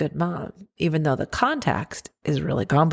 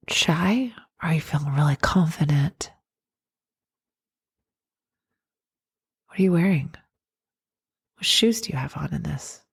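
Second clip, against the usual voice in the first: guided meditation. Acted. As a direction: guide them inward gently. Guided visualization.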